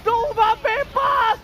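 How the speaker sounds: loud, high-energy esports casting; excited play-by-play. Acted. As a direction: call the teamfight at full volume. Live hype play-by-play.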